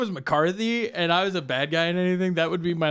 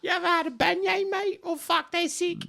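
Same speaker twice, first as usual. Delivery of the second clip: put-on much higher, fast British accent; chirpy British mate; mock British accent. British fighter praising beignets, quick put-on British accent.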